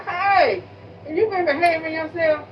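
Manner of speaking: playful scolding holler, re-enacted; calling back. Calls back to him in retold exchange.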